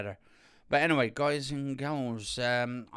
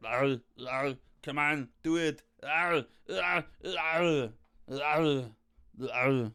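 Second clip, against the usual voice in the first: repeated short hammed-up cries; mock action-hero yells; 3 semitones higher, slower than usual. Shown as a demonstration. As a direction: yell it like a film hero, stepped back. Repeats yells further back from mic.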